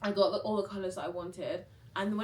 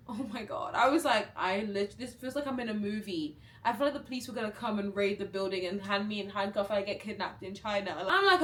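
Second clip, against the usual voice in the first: ordinary talk, nothing marked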